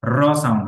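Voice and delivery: র rolled with the tongue tip vibrating; trilled র. Shown as a demonstration. Teacher explaining tongue vibration, trilled র. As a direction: roll out the র before the word. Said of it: "ডগাটা vibration হবে".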